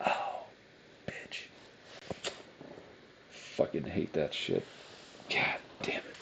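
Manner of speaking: soft, low grumbled swearing under the breath; quiet seething curses; muttered cursing. Real, not acted. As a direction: mutter it to yourself, still seething. Fuming after the outburst.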